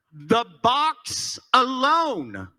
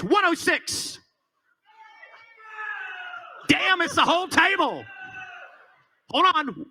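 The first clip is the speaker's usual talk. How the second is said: auctioneer; 6 semitones higher than usual. Acted. Calling out bid numbers.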